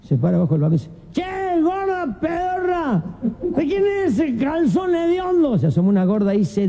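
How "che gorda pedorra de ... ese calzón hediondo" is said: high, drawn-out holler up to someone; hollered call. Acted. Drunk to fat woman on the balcony, calling out high and slow.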